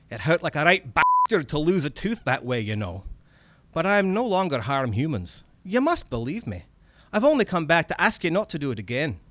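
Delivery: earnest little Scottish man; Scottish accent. Acted. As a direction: plead your case in a Scottish accent. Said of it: "my bad Scottish accent comes in now".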